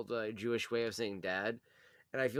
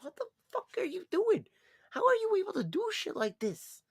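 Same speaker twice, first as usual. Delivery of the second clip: high, shocked squeal; shocked disbelief; 4 dB louder than usual. Retold or imitated. Watching his sister hack dad's phone, high shocked squeal. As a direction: act stunned at the clever toddler. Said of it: "And you're like".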